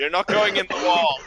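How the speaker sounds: high, loud exclamation; mock yell. Mock outburst at wall mishap.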